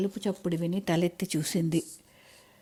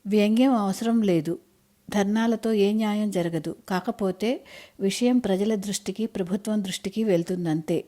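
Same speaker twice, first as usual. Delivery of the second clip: plain reading voice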